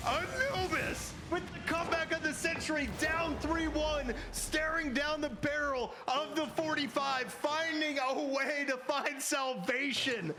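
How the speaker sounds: hyped commentator. Real, not acted. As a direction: savour the comeback of the century. Calling the series-winning comeback.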